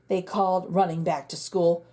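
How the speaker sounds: ordinary delivery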